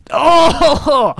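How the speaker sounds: high, loud outburst of amazement; real astonished whoop; excited shock. Real, not acted. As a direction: cry out at a spectacular crash. Reacting to big crash launch.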